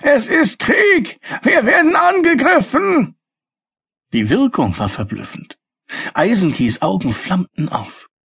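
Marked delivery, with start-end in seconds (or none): 0.0-3.2 gleeful alarm shout. Acted. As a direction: raise a fake alarm with relish. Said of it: "brüllte er schadenfroh".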